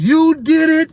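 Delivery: high, loud despairing movie cry; hammed-up film shout, slowing at the end; movie line impression. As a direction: yell it like the betrayed hero on the beach. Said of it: "like on, uh, Planet of the Apes".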